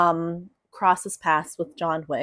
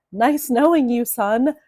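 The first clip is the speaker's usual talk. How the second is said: grim farewell; 5 semitones higher, 5 dB louder than usual. Retold or imitated. Mobster's thought to his son.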